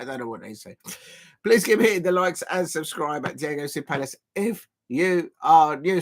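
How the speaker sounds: ordinary delivery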